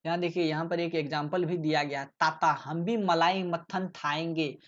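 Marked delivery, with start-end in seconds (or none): 2.0-4.6 put-on slow childlike "ताता" for chacha; child's lisp. Shown as a demonstration. Teacher reads textbook child's lisping line. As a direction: lisp like a small child, slowly. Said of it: "यहाँ मतलब है कि".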